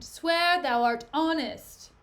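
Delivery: acted forceful order; demanding. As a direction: demand an oath, hammering each word. Othello demands an oath of honesty.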